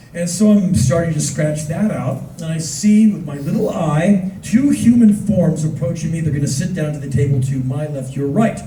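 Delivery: usual speaking voice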